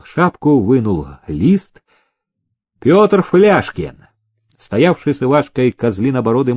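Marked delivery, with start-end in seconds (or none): 2.8-4.1 higher, slow, firm call; roll call. A narrator's reading. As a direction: call the name out to a crowd. Overseer calls names from a list.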